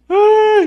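high, loud mocking "ay" cry; a loud yelp imitating her cry; mock wail. Preacher imitating the woman bursting into tears, loud wail.

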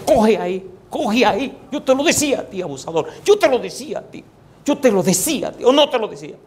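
high, teasing told-you-so voice; a mock divine scolding, pitched high; scolding God. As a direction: needle him from above, pitched high.

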